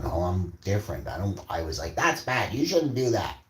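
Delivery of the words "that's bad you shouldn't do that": higher-pitched reproach; lightly acted telling-off; mock scolding. Acted quote, teen scolding classmates.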